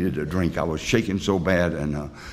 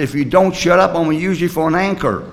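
mock angry threat; 8 semitones higher, 4 dB louder than usual. Threatening his AA sponsor while fishing.

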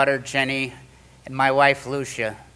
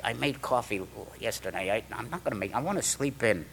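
grumbled refusal; 4 semitones lower, 10 dB quieter than usual. Retold or imitated. Retold nightly refusal to make coffee.

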